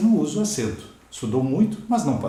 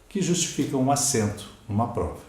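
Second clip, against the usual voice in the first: ordinary talk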